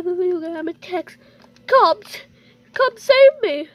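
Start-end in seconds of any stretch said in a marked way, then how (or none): 1.7-3.7 higher, louder hammed-up call for help; mock plea. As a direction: beg the cops dramatically. The owner texts the police.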